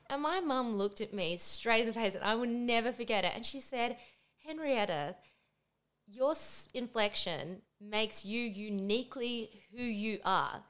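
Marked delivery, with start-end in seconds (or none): 4.5-10.6 slow, soft, motherly sincerity; solemn reassurance. Retold or imitated. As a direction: reassure your child slowly and deliberately. Mum's solemn reassurance, retold.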